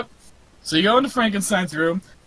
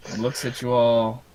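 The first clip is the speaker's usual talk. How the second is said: ordinary talk, nothing marked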